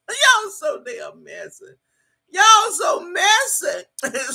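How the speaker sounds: high, drawn-out, laughing delivery; amused scolding. Real, not acted. Streamer teasing her messy chat, amused and laughing. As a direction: stretch it out through laughter.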